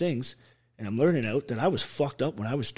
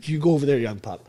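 mock command; 5 semitones higher, 9 dB louder than usual. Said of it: "the other cop's like".

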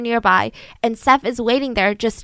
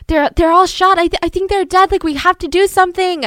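high, louder, breathless panic; panicked plea; 8 semitones higher, 6 dB louder than usual. Retold or imitated. Son telling the neighbor his family is dead, panicked. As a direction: blurt it out in a panic.

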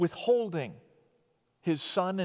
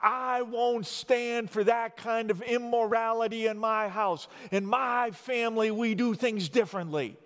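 higher-pitched, worked-up mock outrage; indignant shouting; 5 semitones higher than usual. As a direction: rant like an outraged patriarch laying down the law. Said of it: "he starts shouting things like".

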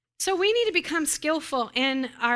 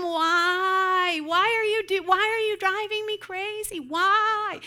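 high, exasperated whine; hammed-up wail of a worn-out parent; whining mom; 8 semitones higher, 4 dB louder, slower than usual. Mom asks kids why they drive her crazy, whining. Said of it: "look at Mom whine".

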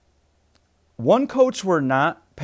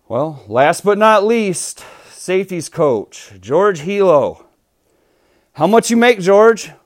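reporter voice; 3 semitones higher, 7 dB louder than usual. Acted. Mock press conference interviewer.

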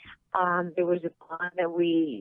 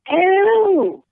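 sharp high cry of surprise, much louder; startled ew; 13 semitones higher, 9 dB louder than usual. Real, not acted. Guest reacts to the show name with a loud squeal. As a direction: recoil at the mention, squealing it. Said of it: "She's all".

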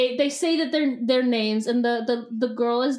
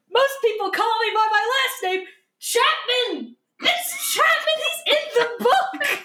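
high, gushing fan squeal; excited gushing; 10 semitones higher than usual. Retold or imitated. Fan gushing about Chapman, high squeal. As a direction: squeal the name like a thrilled fan. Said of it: "he's like".

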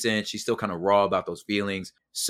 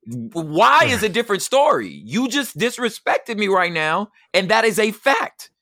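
high and loud, hammering the point; firm indignant retort; 10 semitones higher, 5 dB louder than usual. Retold or imitated. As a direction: insist she owns what she did. Retold argument with wife.

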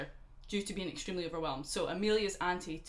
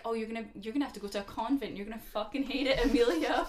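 taunting mother, laughing at the end; mock mean mother; 4 semitones higher, 5 dB louder than usual. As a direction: sneer it like a spiteful mum. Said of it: "So her mum was like".

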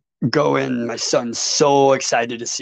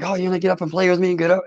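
higher, fast, lightly voiced excited kid; eager little son; 7 semitones higher, much faster than usual. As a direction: rattle it off like a thrilled small boy begging to play. Excited young son asking dad.

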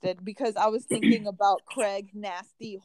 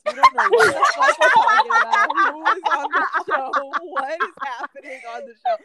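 high, loud, fast laughing outburst; laughing exclamation; 8 semitones higher, 9 dB louder than usual. Real, not acted. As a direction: blurt it out through laughter. Laughing at their own confusion.

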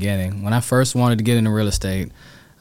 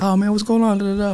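much higher, bright hello; friendly greeting; 11 semitones higher than usual. Retold or imitated. Sean greeting an old friend at Bar Louie, cheerful. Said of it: "He's like".

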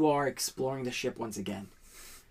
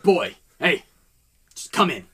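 gruff captain; 4 semitones higher, 9 dB louder than usual. Acted. Captain calls the boy in.

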